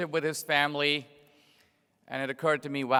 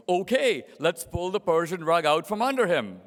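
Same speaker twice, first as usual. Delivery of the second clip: Jimmy Carter; 2 semitones higher, 4 dB louder than usual. Retold or imitated. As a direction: play a cheerfully clueless leader agreeing at once. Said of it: "So Jimmy Carter goes".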